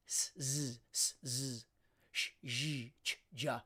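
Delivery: s then z, sh then zh, ch then ja, alternating unvoiced and voiced; voiceless then voiced pairs. Shown as a demonstration. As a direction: drill each voiceless sound and then its voiced partner. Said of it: "the voiceless and then the voice".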